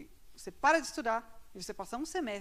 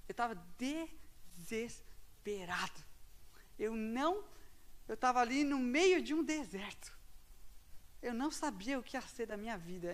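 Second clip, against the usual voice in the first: normal talking voice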